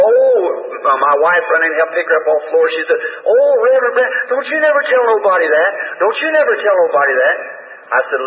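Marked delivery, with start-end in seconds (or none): 0.0-0.3 high, loud startled oh; cry of alarm. Retold or imitated. Preacher imitating the woman crying out as she faints, high and loud. 3.2-7.4 desperate plea. Retold or imitated. Preacher imitating the ashamed woman begging him never to tell, high and pleading.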